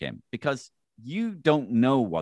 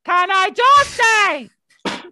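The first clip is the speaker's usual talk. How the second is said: very high, loud, drawn-out shriek; Joan Burton; 12 dB louder, much slower than usual. Acted. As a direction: shriek it like an over-the-top cartoon caricature. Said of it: "does not sound like this".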